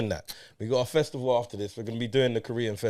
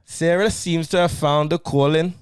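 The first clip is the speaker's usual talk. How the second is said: Scouse attempt drifting towards Caribbean, higher and louder; botched Scouse accent; 4 semitones higher, 5 dB louder than usual. Host reading a film synopsis to co-host, botched Scouse accent. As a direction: try Scouse and miss it. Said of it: "where in the Caribbean are we".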